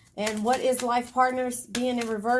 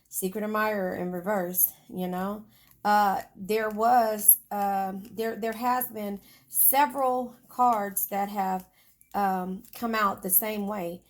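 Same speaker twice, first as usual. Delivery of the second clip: ordinary talk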